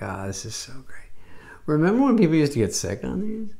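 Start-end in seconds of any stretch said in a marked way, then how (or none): 0.0-1.1 breathy mock-content whisper. Comedian whispers as an imagined cruise passenger. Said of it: "Could you be like".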